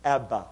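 Abba said as two clear syllables, AB-ba. Shown as a demonstration. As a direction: say Abba slowly in two beats, AB-ba. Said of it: "It's two syllables".

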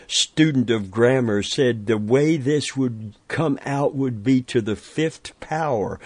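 usual speaking voice

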